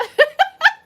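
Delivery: high, loud burst of laughter; loud high cackle. Real, not acted. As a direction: let out a cackle. Laughing at the chat.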